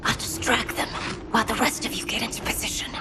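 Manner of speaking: hushed, fast whisper; whispering the ambush plan to her allies. Acted. Plotting an ambush near enemies.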